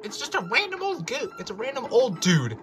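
incredulous. A narrator's reading. The ghost turns out an old man, incredulous. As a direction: squeal it in astonished realisation. Henry sees who the ghost is.

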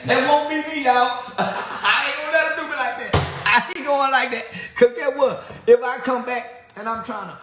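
high-pitched hype rant; high excited ranting. Real, not acted. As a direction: shout the boast at full excitement.

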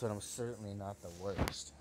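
usual speaking voice

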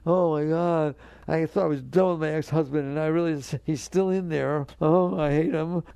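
hammed-up wail of frustration; mock exasperation. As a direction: play the annoyed ex-wife complaining about her ex-husband. Voicing a frustrated ex-wife.

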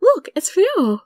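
high, slow onlooker's voice; curious passerby. A narrator's reading. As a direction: point him out in a high-pitched voice. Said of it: "Hearing the curious voices of people passing by".

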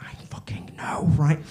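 slow breathy whisper of glee; hushed thrilled whisper; excited whisper. Retold or imitated. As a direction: whisper to your partner so no one hears. Whispering to partner grabbing arm.

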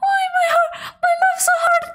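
high mock-sobbing lament; upset whining. Real, not acted. Gamer upset over a key, high whiny wailing. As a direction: bewail it at a high pitch. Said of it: "getting upset over a key".

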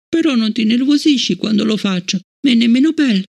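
put-on high young girl's voice, hurt and puzzled; a child asking why she is disliked; child Fallon. Fallon asking Bliss about Travis, high child voice.

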